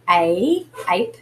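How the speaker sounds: A drawn out like its letter name, as in ape; slow, held long A; long A sound. Shown as a demonstration. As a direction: stretch the long A, then ape. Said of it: "has a long sound like".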